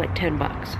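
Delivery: usual speaking voice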